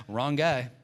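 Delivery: a touch of mock toughness; tough retort. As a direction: answer like a man ready to fight. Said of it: "I'm like".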